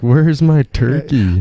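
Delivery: put-on old neighbor demanding his bird; angry old farmer. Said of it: "Richard comes over and he's like".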